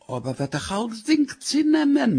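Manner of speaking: very high wail of protest; wailing worry, pitched far up; alarmed wife. Acted. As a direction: plead in a high, panicky voice.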